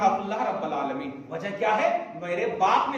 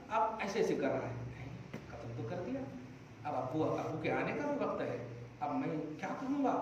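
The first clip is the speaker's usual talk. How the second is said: worried murmur; 6 semitones lower, 10 dB quieter than usual. Acted. Qabil panicking after the murder.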